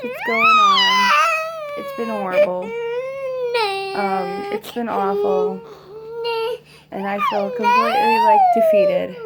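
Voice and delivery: words breaking through real sobs; crying. Real, not acted. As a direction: cry it out, voice cracking and high. Mother crying, feels defeated.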